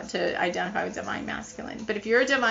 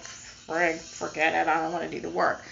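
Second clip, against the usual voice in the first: lightly voiced, lower fed-up man giving up; mock a guy throwing in the towel, lower; giving-up partner; 4 semitones lower, 3 dB quieter than usual. Voicing a DM who gave up.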